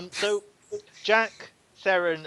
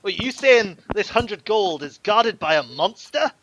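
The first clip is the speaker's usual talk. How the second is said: higher, loud and slowed, taken aback; alarmed thug; 7 semitones higher, 11 dB louder, slower than usual. Acted. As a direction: balk at the word monster. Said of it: "he goes".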